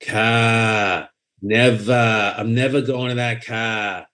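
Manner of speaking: overdone Boston accent, stretching the vowel in car; dragged-out Boston accent. Shown as a demonstration. Writer showing how actors botch it, dragged Boston accent. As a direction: drag out every word in bad Boston. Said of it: "the mistake that people make is they drag it".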